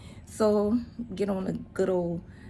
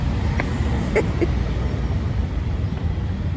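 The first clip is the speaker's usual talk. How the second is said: laughing at the son's accent attempt; laughter; 7 dB louder than usual. Real, not acted.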